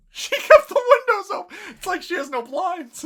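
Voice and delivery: high, loud and laughing; incredulous laughing outburst; laughing protest. Real, not acted. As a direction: exclaim it through laughter. Cracking up while objecting.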